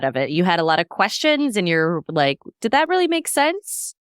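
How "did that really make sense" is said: high, incredulous questioning; acted puzzlement with a big upward lift; skeptical fan. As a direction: query it in a raised, doubting pitch. Voices a questioning fan's reaction.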